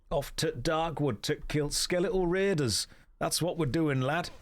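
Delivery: put-on Yorkshire lad; Yorkshire accent. Streamer setting off to kill skeletal raiders, Yorkshire accent. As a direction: announce the task in mock Yorkshire. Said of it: "That's the one".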